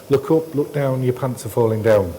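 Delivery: normal talking voice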